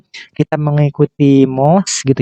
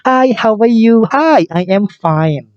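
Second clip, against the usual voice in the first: friendly greeting; 7 semitones higher, 4 dB louder, slower than usual. Shown as a demonstration. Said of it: "teman yang sudah kita kenal sebelumnya".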